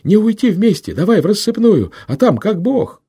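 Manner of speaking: high, loud panting yell; breathless shout. A narrator's reading. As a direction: shout it out of breath while running. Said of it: "задыхаясь, крикнул Минька".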